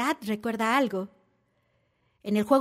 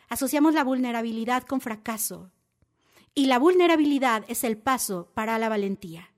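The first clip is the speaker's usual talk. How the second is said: ordinary talk, nothing marked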